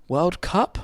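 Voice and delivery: retold baffled question, rising at the end; puzzled query. Credentials woman mishears the artist's name, puzzled. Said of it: "And she was like".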